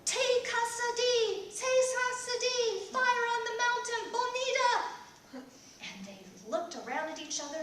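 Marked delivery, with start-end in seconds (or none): 0.1-5.4 high, loud, slow chant; yelled charm. A narrator's reading. Daughter yelling the charm at the spirits, loud and slow. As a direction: cry the spell out to drive them off. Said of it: "she yelled".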